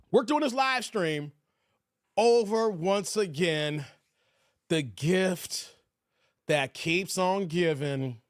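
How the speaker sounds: slow, spaced-out grand intro; show announcer. Pretend. Host building up tonight's subject, slow announcer delivery. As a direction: draw out each phrase with big pauses.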